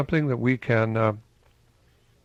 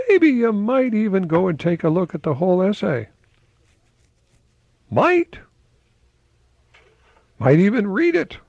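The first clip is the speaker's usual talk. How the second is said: much higher, playful coaxing; teasing; 10 semitones higher than usual. Real, not acted. Host teasing viewers to read the whole essay. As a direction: nudge them with a grin. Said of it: "teasing viewers to read essay".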